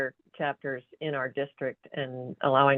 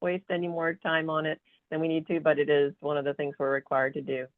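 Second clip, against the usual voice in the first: ordinary talk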